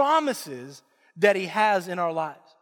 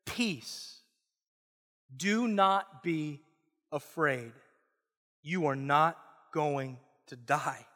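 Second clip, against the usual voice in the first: gentle reassurance; 8 semitones lower, 7 dB quieter, slower than usual. A narrator's reading. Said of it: "But the Lord said to him".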